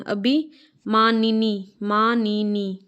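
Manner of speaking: अभिमानिनी said very slowly, each syllable apart; teacher dictating अभिमानिनी slowly to the class; slow spelling demo. Shown as a demonstration. अभिमानिनी धीरे-धीरे बोलना. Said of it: "अभिमानिनी होता है".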